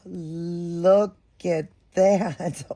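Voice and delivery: high, slow, drawn-out cry of delight; gushing delight. Real, not acted. To viewers seeing the doll's outfit, a slow high gush. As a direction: melt over the first glimpse. Unboxing reborn doll, first sight.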